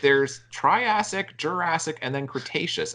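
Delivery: mildly exasperated pedantry, opening high; exasperated correction. Retold or imitated. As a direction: list them emphatically, as if correcting someone. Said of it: "And I'm like".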